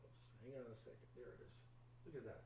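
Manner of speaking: distant, far from the microphone; speaking away from the mic. Real, not acted. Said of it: "Mark, you're off mic".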